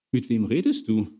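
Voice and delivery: ordinary talk, nothing marked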